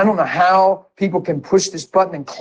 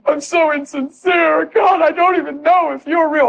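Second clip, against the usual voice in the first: anguished sobbing prayer; bawling confession; 8 semitones higher than usual. Retold or imitated. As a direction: sob it out in anguish. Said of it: "I bawled and I said".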